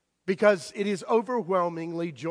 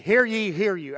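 mock town crier call, a touch louder; mock herald shout; 4 semitones higher, 7 dB louder than usual. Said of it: "he's really shouting it out".

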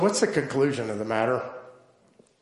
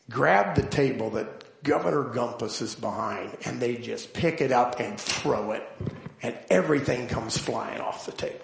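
ordinary delivery

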